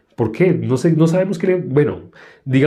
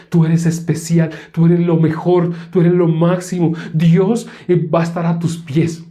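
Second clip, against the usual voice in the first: mock flattering preacher; 5 semitones higher than usual. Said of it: "donde le dicen".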